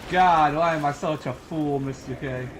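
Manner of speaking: ordinary delivery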